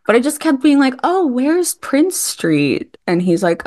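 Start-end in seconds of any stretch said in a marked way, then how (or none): 1.0-3.0 slow, high-pitched fake cluelessness; acting dumb. Fan feigning being lost to a TV actor, acting dumb. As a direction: draw it out, innocently lost. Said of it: "I kept acting dumb".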